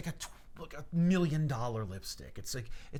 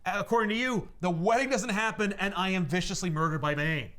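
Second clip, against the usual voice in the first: raised pitch, slow and emphatic speech; heartfelt declaration; 6 semitones higher than usual. Retold or imitated. Butler thanks doomed-wedding visitor, emphatic heartfelt speech. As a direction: stretch the key words like a grand tribute.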